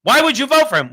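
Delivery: indignant elitist. Retold or imitated. An offended elitist scolding Trump voters, mock outrage. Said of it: "they say".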